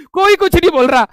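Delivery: usual speaking voice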